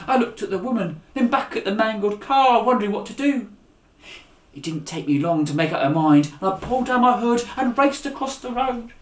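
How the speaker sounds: put-on lively read-aloud storytelling for children; animated children's story narration. A narrator's reading. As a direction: read it like a bedtime adventure. Reads the chapter aloud.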